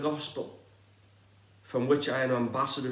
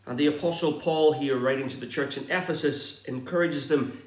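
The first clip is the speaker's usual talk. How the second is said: normal talking voice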